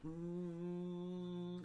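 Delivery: long, steady feedback tone; imitated feedback hum. Breaking the moment of silence with imitated feedback. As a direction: hold one droning note like feedback. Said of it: "I gotta do feedback".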